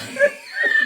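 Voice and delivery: high burst of laughter; loud laugh. Real, not acted. Teasing husband, bursts laughing.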